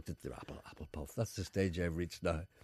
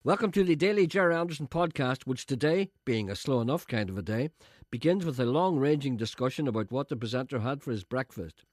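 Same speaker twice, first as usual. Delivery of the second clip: higher, louder radio presenter read; mock announcer; 3 semitones higher, 4 dB louder than usual. Introducing his own podcast, a mock announcer voice. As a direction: announce it like a pompous show opener.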